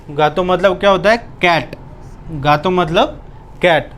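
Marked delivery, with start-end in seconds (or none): none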